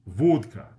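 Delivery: "vodka" with a long rounded O, VOHD-ka; Russian "vodka", stressed round O. Shown as a demonstration. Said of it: "example of a stressed O".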